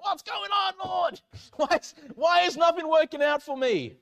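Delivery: high, loud mock wail at the Lord; a hammed-up complaint to heaven; frustrated outcry. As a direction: cry out why me, half laughing. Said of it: "I'm, like, frustrated".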